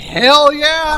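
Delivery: loud high whoop; excited cheer. Real, not acted. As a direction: cheer it out with gusto. Cheering hell yeah.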